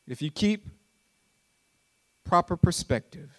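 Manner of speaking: low, soft, intimate preacher voice; hushed preaching. Acted. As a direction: draw them close, quietly.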